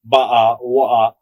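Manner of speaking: loud Cockney glottal stops; Cockney accent. Shown as a demonstration. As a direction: demo of Cockney with dropped Ts. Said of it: "Not like Cockney".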